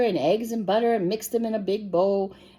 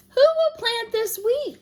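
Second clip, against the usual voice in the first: high, loud hen call; hen crying out; 5 dB louder than usual. A narrator's reading. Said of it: "cried the little red hen".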